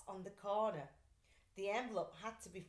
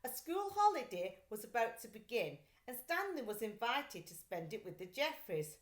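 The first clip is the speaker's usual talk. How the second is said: usual speaking voice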